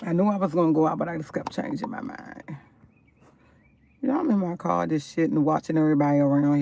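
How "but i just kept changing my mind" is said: whispered repeat, no voice at all. Shown as a demonstration. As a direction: breathe it out as a secret.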